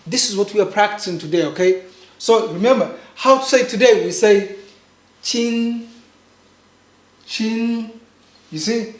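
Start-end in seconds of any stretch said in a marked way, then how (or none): 5.2-7.9 CHIN held long on one flat, level pitch; first tone. Shown as a demonstration. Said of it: "make sure it's flat".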